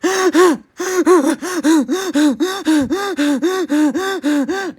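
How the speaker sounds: loud high burst of laughter; big laugh. Real, not acted. Laughing at own words.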